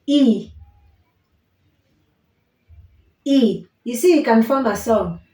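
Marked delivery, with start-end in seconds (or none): 0.1-3.7 I said like ee, clear; vowel I. Shown as a demonstration. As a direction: model the vowel I written E. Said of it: "The third one".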